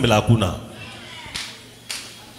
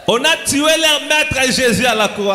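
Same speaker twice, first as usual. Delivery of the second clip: a preacher crying out the crucifixion; shouted preaching; 8 semitones higher, slower than usual. Real, not acted. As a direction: proclaim it to the whole church.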